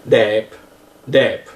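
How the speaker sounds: "đẹp" slowly with a French ê and low tone; open E. Shown as a demonstration. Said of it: "Vous voyez, c'est un E". (« đẹp » avec un ê ouvert et grave)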